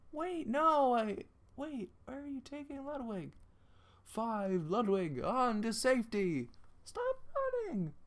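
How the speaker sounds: high, upset voice calling after them; a hint of a desperate pursuer; pleading chaser.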